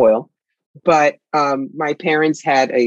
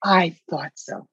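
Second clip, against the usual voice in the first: breathy voice; 3 semitones higher, 4 dB quieter, slower than usual. Retold or imitated. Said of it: "And he'd say".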